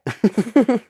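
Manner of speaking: high laugh. Real, not acted. Laugh after incredulous what.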